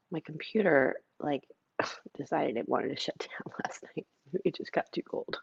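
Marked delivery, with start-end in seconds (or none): 1.2-5.4 laughing whispered aside. Real, not acted. Host explaining her computer shut down, giggly whisper.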